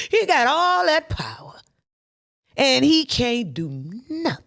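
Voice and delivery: full-force sermon delivery; preacher like daddy. Acted. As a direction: preach it like an old-time pastor. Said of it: "I feel like my daddy up here".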